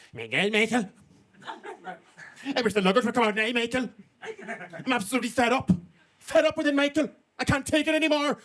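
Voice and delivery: a hint of a fussy granny nattering to herself; Mrs Doubtfire-esque voice. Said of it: "Missus Doubtfire-esque voice".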